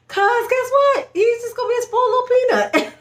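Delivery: high giggly gushing; gushing excitement. Real, not acted. Gushing about baby's gifts.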